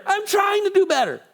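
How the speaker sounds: very high, strained pleading; desperate protest. Acted. Acted desperate reply to scolding God.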